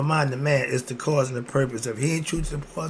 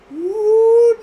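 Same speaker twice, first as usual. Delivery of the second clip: very high, loud hooting woo; sarcastic falsetto whoop; 10 dB louder than usual. Acted. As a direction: whoop like a mock cheer. Mock-excited exclamation.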